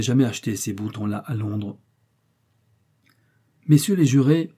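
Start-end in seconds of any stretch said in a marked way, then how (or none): 3.7-4.5 higher, louder courtroom appeal; exclaiming. A narrator's reading. Defence lawyer addressing the jury, exclaiming. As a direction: call out to the jury with force. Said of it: "s'écria Horace Weldon".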